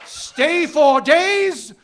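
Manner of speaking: slow, high and loud, savoring each word; triumphant slogan. Acted. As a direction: announce it like a winning slogan.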